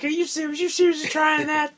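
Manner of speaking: high, incredulous mock voice; mocking Booker T. Booker T turning her down, high and incredulous. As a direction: play the wrestler brushing off her come-on in disbelief. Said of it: "He's like".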